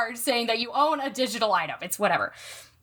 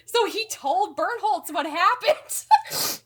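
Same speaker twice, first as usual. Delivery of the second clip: laugh-sobbing; 6 semitones higher, much slower than usual. Real, not acted. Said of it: "I'm, like, sobbing".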